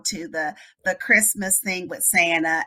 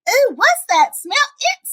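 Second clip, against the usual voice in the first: put-on high, loud child voice; complaining little boy; 7 dB louder than usual. Boy smelling something at the lady's house, loudly disgusted. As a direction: blurt it out like a disgusted kid. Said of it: "he's gonna be like".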